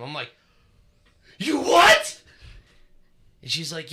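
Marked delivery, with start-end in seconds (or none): 1.4-2.2 very high, loud shout of disbelief; retold outraged shout. Man hears ex cleaned off webs, disbelieving shout. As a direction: yell it in shock.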